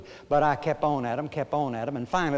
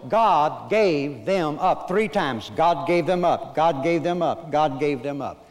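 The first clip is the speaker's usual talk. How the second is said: loud, ringing scripture proclamation; forceful preaching; 3 semitones higher, 6 dB louder than usual. Real, not acted. Preacher hammers home a repeated verse to his congregation, loud and emphatic. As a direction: preach it out, drawing out the repeated phrase.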